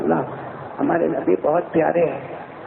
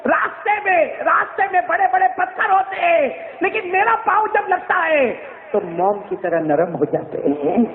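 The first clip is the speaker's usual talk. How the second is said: preaching at full force; 12 semitones higher than usual. Acted. Preacher recounting Amina to the gathering, full-force preaching. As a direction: preach it out at the top of the voice.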